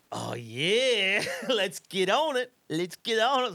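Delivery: high, loud, laughing driver voice; excited Vietnamese driver. Retold or imitated. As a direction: driver hyping the ride, accented and gleeful. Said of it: "he goes".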